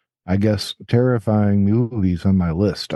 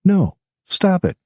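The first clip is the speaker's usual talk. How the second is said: high, slow mock protest; playful protest; 10 semitones higher than usual. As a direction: tease them to stop it.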